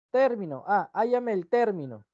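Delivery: "Término" as TÉR-mi-no both times; stress on first syllable. Shown as a demonstration. Teacher giving an example of the noun, "término" stressed on TÉR. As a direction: lean on TÉR in each "término". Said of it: "estoy pidiendo un elemento".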